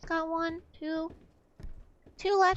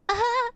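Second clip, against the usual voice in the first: scared yelp; 6 semitones higher, 3 dB louder than usual. Real, not acted. Scared cry.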